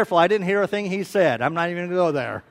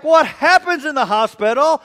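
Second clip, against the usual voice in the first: loud, high mock outburst; hammed-up loud question; 7 semitones higher, 9 dB louder than usual. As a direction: blurt it out in mock alarm. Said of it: "I'm like".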